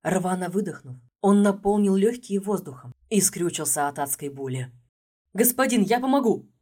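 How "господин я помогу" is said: determined call. A narrator's reading. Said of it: "решительно шагнул".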